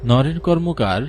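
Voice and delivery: usual speaking voice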